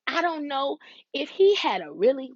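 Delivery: ordinary talk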